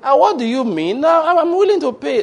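high, loud pleading protest; the debtor squeals in disbelief, begging to pay; shocked insisting. Acted. Debtor pleads with the lender who refuses the money, shocked and high-pitched. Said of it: "debtor insists".

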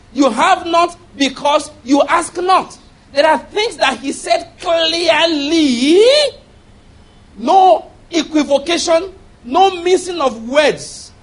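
loud, ringing preacher's voice with heavy emphasis; preaching at full force. Real, not acted. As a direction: raise the voice and thunder it.

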